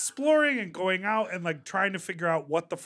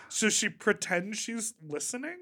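ordinary delivery

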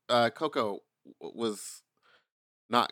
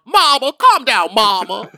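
much higher and louder, slow campy coaxing; mock soothing man; 8 semitones higher, 10 dB louder than usual. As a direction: coo at her like a campy friend calming her down. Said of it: "he's over there going".

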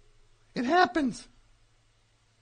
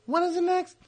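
ordinary talk, nothing marked

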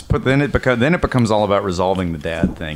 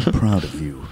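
ghostly voice; 6 semitones lower, 4 dB quieter than usual. Retold or imitated. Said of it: "ghostly voice of his father".

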